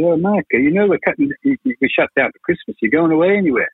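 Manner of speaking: high, imitated Scottish voice; bad Scottish accent. Producer catching him in the hallway, bad Scottish accent. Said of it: "doing a bad Scottish accent".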